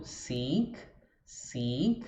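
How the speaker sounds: "seek" with a long i, mouth spread wide; long English ee. Shown as a demonstration. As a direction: stretch the vowel of "seek". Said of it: "tenho que abrir a boca lateralmente". ("seek" com I longo, boca aberta dos lados)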